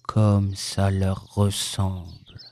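low, soft, slow donkey gloom; sombre. A narrator's reading. Donkey blaming whoever took his tail, sombre. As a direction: mourn it quietly, deep and drawn out. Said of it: "d'un air sombre".

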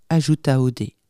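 usual speaking voice

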